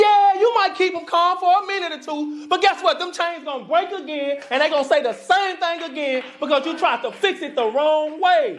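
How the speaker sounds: high-pitched, fired-up sermon delivery; impassioned preaching. Real, not acted. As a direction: preach it with urgency, voice lifted high.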